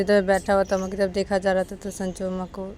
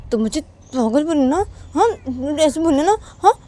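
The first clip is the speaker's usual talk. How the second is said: mildly indignant, raised voice; objecting Uma; 8 semitones higher, 8 dB louder than usual. Acted. Uma daring Sanju to call her mad, raised indignation. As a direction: challenge him loudly.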